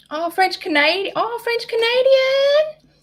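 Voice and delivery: very high, excited exclamation, rising and stretching out; excited squeal. Real, not acted. Reacting to someone being French Canadian, excited squeal.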